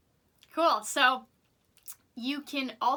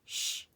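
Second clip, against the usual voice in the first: shushing. Shown as a demonstration. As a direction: shush like hushing a noisy friend. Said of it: "you're gonna go".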